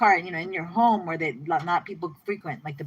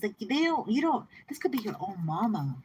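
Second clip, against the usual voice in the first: usual speaking voice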